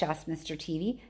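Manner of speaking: ordinary delivery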